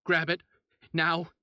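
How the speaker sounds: mayor ordering the police to seize the body, loud; barked order. Acted. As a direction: cut him off and bark it. Mayor ignores doctor, orders police.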